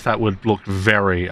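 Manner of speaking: normal talking voice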